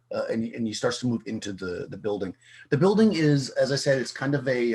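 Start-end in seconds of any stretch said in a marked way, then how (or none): none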